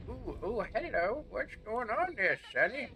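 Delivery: mock British old man. Gamer greeting an enemy as "Sonny", mock British old-man voice. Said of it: "I'm mixing an old man voice".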